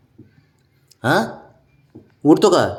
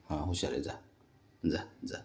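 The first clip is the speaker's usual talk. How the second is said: ordinary talk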